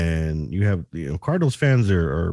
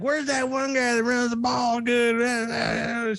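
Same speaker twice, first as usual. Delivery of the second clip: very high, slow mock woman's voice; squeaky drawn-out lady question; clueless lady fan; 4 dB quieter, much slower than usual. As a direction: play her as a confused fan asking around. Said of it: "She was like".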